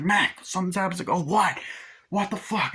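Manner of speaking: panicked crew. Retold or imitated. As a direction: blurt it out in panic at the monster.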